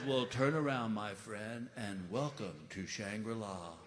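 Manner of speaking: slow stoner voice. Retold or imitated. Stoner kid voiced in story.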